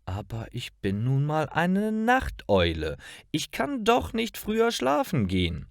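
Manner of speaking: soft, high-pitched plaintive protest; sighing protest. A narrator's reading. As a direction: protest softly, rising high. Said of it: "Lara seufzt".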